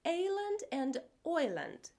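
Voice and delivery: Ireland shifted between two vowels; Ireland as Oiland. Shown as a demonstration. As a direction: show Ireland drifting toward Oiland. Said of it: "sounds like a cross between".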